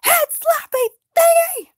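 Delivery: high, loud, slow outburst; angry squeal. Acted. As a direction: curse the creature in annoyance. Angry after slap.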